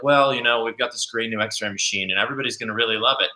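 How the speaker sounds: dry, low and subdued delivery; all dry. Shown as a demonstration. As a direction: deliver it drily, dropping pitch and volume. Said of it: "you can get all dry".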